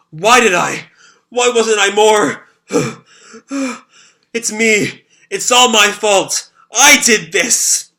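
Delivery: self-blaming anguish. Acted. Shiro sobbing, blaming himself.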